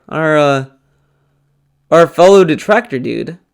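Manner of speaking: normal talking voice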